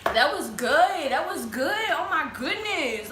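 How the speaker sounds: slow, raving food praise; savoring it. Real, not acted. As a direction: savor every word of it.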